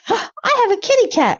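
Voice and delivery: put-on high cutesy baby voice; high, sweet kid voice. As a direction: read it like a tiny child showing off a kitten. Cutesy voice reading chat.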